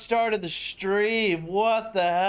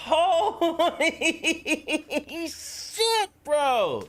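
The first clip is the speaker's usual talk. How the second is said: much higher, laughing through the words; laughing disbelief; 9 semitones higher, much slower than usual. Real, not acted. Streamer laughing in shock at a donor's gift.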